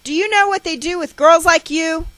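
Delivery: loud, high ranting mother; sustained furious scolding; harsh tirade. A narrator's reading. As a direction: berate and threaten the child at a shout. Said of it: "She continues her harsh tirade".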